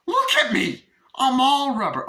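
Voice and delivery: very high, loud mock shout; exasperated outburst. Chucky pointing out to Tiffany he is rubber, squawked exasperation.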